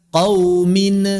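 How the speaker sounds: "qaumin" with a bounced n, a tiny vowel after it; nun memantul salah. Shown as a demonstration. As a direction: release the n of "qaumin" into a short "ne". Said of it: "Nggak dibaca". ("qaumin" dengan nun dipantulkan seperti "qaumine")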